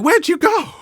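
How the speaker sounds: high, puzzled call into the empty street; mock surprise. Mocking the vanished strangers, high and puzzled. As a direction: pretend to look around for them.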